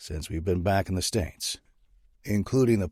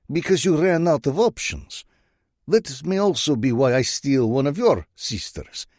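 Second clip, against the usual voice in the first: put-on higher, louder threatening Russian-accented purr; menacing Russian accent; 7 semitones higher, 5 dB louder than usual. Bratva boss threatening to steal Saint's woman, menacing Russian accent. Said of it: "a voice laced with a Russian accent".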